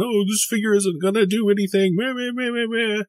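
high-pitched mock naysayer, trailing into meh-meh-meh; mocking imitation. Teasing a friend who doubted the figure, mocking imitation. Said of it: "you swore up and down".

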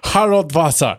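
put-on playful German-accented name. Friend repeating the made-up wizard name, mock German accent. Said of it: "that sounded really German".

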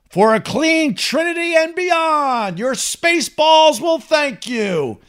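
high, slow, hammed-up announcer tagline; grand slogan read. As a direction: stretch it into a big space-age sign-off. Hams up the closing ad slogan.

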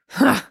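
high, sharp huff of scorn; scoffing huh. A narrator's reading. April dismissing Dad's excuse, scoffing huh. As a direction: puff out a derisive "huh". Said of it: "April scoffed".

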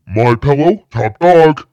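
loud hype slogan; shouted ad tagline. Acted. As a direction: bellow it like a TV ad.

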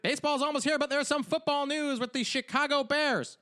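high-pitched mock sports-news announcer; news show. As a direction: deliver it as a hammed-up news bulletin. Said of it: "we're like a news show now?".

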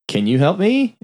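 high-pitched, tentative question; retold appeal. Mia asks the ghost girl for help, retold appeal. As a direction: voice a lightly acted plea for help.

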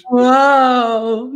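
usual speaking voice

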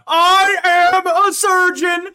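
very high, loud, slow declaration; meme impression, yelled. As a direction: wail each word with overblown outrage.

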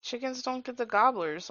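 ordinary delivery

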